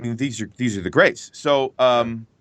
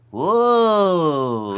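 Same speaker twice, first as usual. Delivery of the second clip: high, silly minion whoa; cartoon minion exclaiming; 7 semitones higher, 4 dB louder than usual. Shown as a demonstration. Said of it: "could you do a minion for us".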